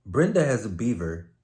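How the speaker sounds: ordinary delivery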